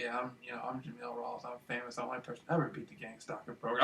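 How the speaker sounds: introducing himself to the woman, whispered and calm; whispering calmly. Retold or imitated. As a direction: lean in and murmur it. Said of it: "I'm all whispering, all calm".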